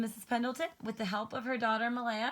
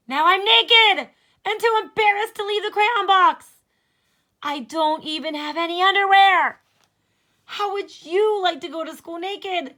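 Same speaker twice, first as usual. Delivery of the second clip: much higher and louder, crying for help; panicked naked crayon; 9 semitones higher, 14 dB louder than usual. Acted. Peach crayon begging Duncan for clothes, crying and shouting. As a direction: wail in embarrassed panic.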